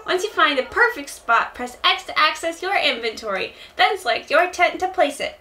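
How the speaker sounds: high-pitched, eager helper voice; little shop helper. Acted. Explaining tent placement, high helper voice. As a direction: chirp the instructions up high. Plays Timmy or Tommy.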